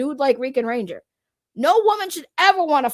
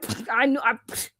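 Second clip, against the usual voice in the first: smug scoff; 5 semitones lower, 5 dB quieter than usual. Retold or imitated. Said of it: "I was like".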